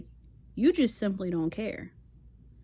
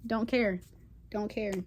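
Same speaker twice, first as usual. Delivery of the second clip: ordinary delivery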